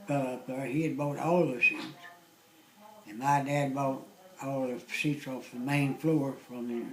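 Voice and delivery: ordinary talk, nothing marked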